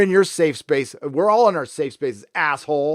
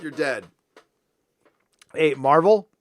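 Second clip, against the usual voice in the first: ordinary talk, nothing marked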